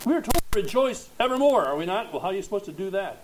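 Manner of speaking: a sudden burst of preaching urgency; rising challenge. Real, not acted. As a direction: press them with a quick rising question.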